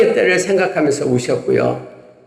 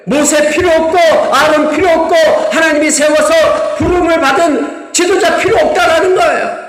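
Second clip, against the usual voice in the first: shouted, much higher sermon climax; fiery preaching; 12 semitones higher, 4 dB louder than usual. Real, not acted. Preacher rails at the congregation about rebellion, shouting.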